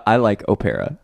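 Opera said oh-PAIR-uh; playful mispronunciation. Pretend. Host picking a favorite, Opera as oh-PAIR-uh. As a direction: stress the PAIR in Opera, after an OH. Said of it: "decide how to pronounce opera".

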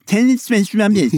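high, loud whiny mock voice; whining voice. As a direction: whine it like an annoying moaner. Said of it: "Mark's annoying whining voice he does".

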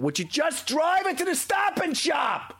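high, slow exasperated yell; neighbors yelling. Retold or imitated. Neighbors yelling at the screaming mother, exasperated. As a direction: shout it across the street at her. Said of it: "the neighbors are going".

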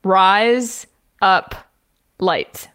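three words with long pauses between; word-by-word demo. Shown as a demonstration. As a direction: say "rise up lights" slowly, spaced out. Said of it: "I was gonna say, say".

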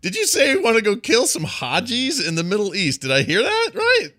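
quizzing a Thanksgiving guest, climbing disbelief; mock incredulous. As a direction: pretend you couldn't possibly have heard that. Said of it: "a smile and kind of a half laugh".

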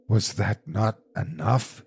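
slow, weighted, controlled fierceness; fierce demand. A narrator's reading. Husband challenging his wife over the wish, fierce. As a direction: demand it sternly, word by word. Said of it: "he demanded fiercely".

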